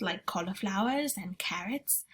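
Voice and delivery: hushed whisper. Acted. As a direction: breathe it, no voice. Sophie whispers suggestion.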